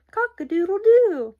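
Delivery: high, loud crowing; rooster crow. A narrator's reading. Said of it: "who crowed".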